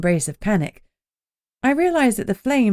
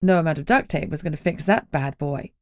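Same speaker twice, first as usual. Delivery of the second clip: ordinary delivery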